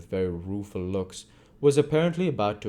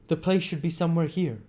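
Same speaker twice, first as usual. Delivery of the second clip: Bumble's voice; 9 semitones higher, 3 dB louder than usual. A narrator's reading. Bumble voiced in read story.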